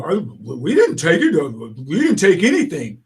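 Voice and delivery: stammering mock innocence; sputtering, evasive group voice; acted denial. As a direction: play flustered culprits denying it. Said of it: "And leftists go".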